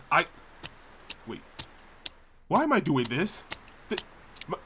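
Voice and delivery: ordinary delivery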